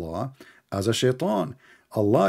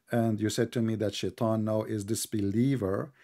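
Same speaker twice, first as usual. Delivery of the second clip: ordinary talk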